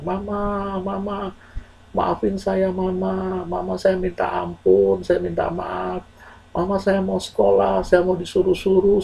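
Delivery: high, soft, slow tearful pleading; acted weeping plea. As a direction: beg a dead mother for forgiveness. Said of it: "Dia terus menangis". (menangis memohon dengan suara lirih)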